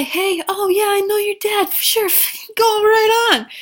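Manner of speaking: high, loud dopey guard voice; mocking dim guard. Dim security guard waving the dead girl past, high and loud. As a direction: play the clueless guard waving them through.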